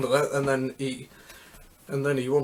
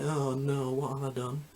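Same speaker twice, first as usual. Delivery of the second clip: soft, muttered regret; quiet dismay; 7 dB quieter than usual. Retold or imitated. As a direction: say it softly to yourself, regretful. Retold regret after the decision.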